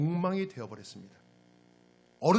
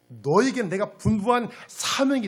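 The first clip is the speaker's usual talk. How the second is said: higher, louder admonishing declaration; rebuke; 5 semitones higher, 7 dB louder than usual. Acted. Angel of the Lord reminding Israel of its mission, forceful rebuke. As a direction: drive each point home with force. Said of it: "이것을 여호와의 사자가 책망하는 것입니다".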